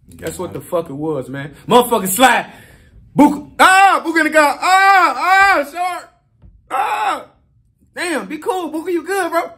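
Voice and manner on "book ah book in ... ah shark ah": high, strained groans of a wounded friend; imitated pained cries. Voicing shot Booker in the car, crying out in pain. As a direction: cry out like someone just got hit.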